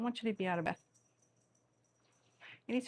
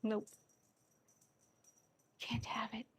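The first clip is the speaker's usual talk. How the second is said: whispered cat voice; 2 semitones higher, 8 dB quieter than usual. Pretend. Owner voicing her cat, whispering its refusal.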